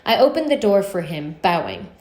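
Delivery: usual speaking voice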